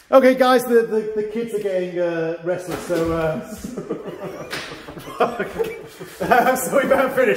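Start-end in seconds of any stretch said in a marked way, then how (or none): 3.5-6.5 breathy, mostly voiceless laughing fit; long laugh. Real, not acted. Instructor amused at the restless kids, laughing. 6.5-7.4 higher, through leftover laughter; laughing. Real, not acted. Instructor wrapping up as kids get restless, laughing.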